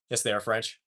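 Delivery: ordinary talk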